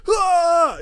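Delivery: high, drawn-out charging scream; hammed-up attack cry; mock battle yell. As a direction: yell as if running in to fight. Acts out charging at the bouncer.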